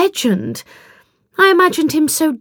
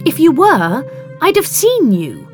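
high, emphatic accusation; indignant, louder retort; exasperated challenge; 7 semitones higher, 3 dB louder than usual. A narrator's reading. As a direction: confront him, pitch jumping up. Heroine snaps, confronting the man.

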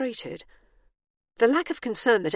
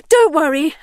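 high, loud reassurance; hasty surrender; 11 semitones higher, 11 dB louder than usual. A narrator's reading. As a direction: call out quickly to armed guards, voice raised. Calling out to arriving guard robots.

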